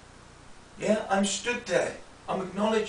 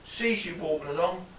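normal talking voice

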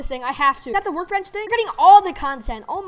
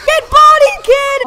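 hyped shout, pitch cracking high; excited yell with a cracking voice; 9 semitones higher, 11 dB louder, much slower than usual. Real, not acted. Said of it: "ignore the voice crack".

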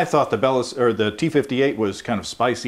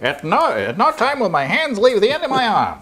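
magician patter; 11 semitones higher, 3 dB louder than usual. Pretend. Mock magician patter, three-card Monty.

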